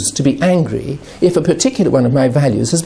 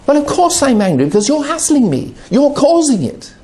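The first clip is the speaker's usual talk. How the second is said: much higher, heated accusation; angry blaming; 10 semitones higher than usual. Retold or imitated. Addict blaming the person hassling him, heated and higher. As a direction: throw the blame at them, worked up.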